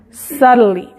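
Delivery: "Subtly" said sah-rly, the tl like an r; teacher demo; flapped tl. Shown as a demonstration. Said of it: "parece que suena como R".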